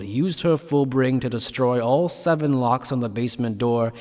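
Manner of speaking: ordinary delivery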